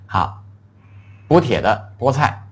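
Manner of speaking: normal talking voice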